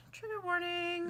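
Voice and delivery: jokey warning voice; mock announcement. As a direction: announce it with fake gravity.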